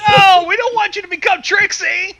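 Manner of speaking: alarmed shout. Real, not acted. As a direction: shout no in playful horror. Listener reacts in joking alarm.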